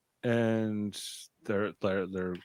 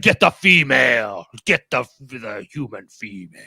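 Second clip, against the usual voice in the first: alien voice; 2 semitones lower, 6 dB louder than usual. Retold or imitated. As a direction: growl it like a reptile alien. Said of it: "they're like".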